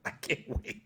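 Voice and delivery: breaking up into wheezy laughter; cracking up, voice dropping away; helpless laughing. Real, not acted. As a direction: laugh until the words barely get out. Cracks up after mocking Trump clip.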